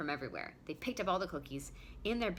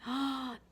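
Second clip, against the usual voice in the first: excited gasp; 7 semitones higher, 4 dB louder than usual. Real, not acted.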